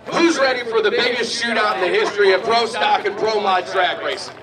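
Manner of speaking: loud, sustained rallying call; crowd hype shout. Real, not acted. Announcer rallies racers and fans for a shootout, hype shout. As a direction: fire up the whole crowd.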